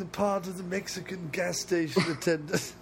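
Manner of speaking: high, posh-sounding actor impression; James Mason. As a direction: announce yourself grandly. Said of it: "if this old guy was played by James Mason".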